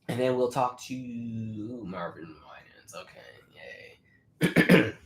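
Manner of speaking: losing voice. Real, not acted. Host losing voice from the change of weather, voice failing. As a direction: voice giving out, very quiet and low. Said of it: "I'm losing my voice".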